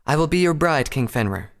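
high, formal feminine voice; high, dropping on the name; resolute princess. A narrator's reading. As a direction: accept bravely in a high voice. Princess accepts the wolf king.

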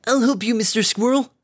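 high, loud eager offer of help; eager high call. Acted. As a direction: call out cheerfully and keen. Derpy eagerly calls while flying over.

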